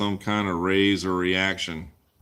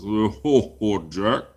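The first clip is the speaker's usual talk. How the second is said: real laugh running into the words; laughing, tickled by the question; amused chuckle; 3 semitones lower, slower than usual. Real, not acted. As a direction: chuckle through it, a little low.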